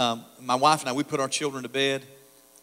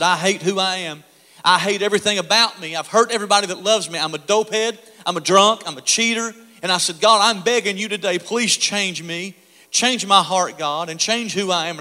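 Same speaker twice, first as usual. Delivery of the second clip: desperate prayer cry; 4 semitones higher, 5 dB louder than usual. Retold or imitated. Retold desperate prayer at the altar.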